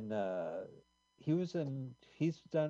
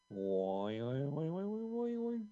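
bouncy springy boingy voice; 3 semitones higher, much slower than usual. Retold or imitated. Imitating cartoon sound effects.

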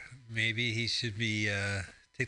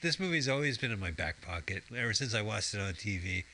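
usual speaking voice

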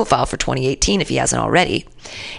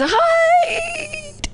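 exaggerated wail. Host re-reading the article in exasperation, wailing the ending. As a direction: start normal, then soar into a long high squeal.

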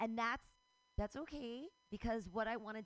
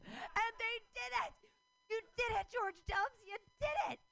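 much higher, louder sarcastic cheering; heated mock cheer; 13 semitones higher, 4 dB louder than usual. Real, not acted. Ranting at the government about education, heated sarcastic shouting. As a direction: shout it like a bitter celebration. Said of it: "Sorry, I get so heated".

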